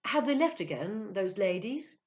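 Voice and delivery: breezy inquiry; casual enquiry. A narrator's reading. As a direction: ask it lightly, in passing. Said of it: "he inquired casually".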